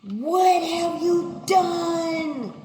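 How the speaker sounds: slow, high, shouted demand; God's thundering question. Retold or imitated. God calling Cain to account, slow loud shout. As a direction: boom it out as God confronting. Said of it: "he said".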